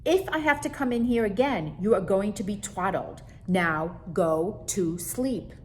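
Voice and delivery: angry scolding mother. Acted. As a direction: scold the little monster hard. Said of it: "she said".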